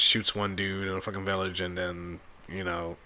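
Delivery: usual speaking voice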